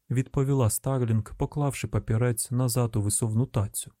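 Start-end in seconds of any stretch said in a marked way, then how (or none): none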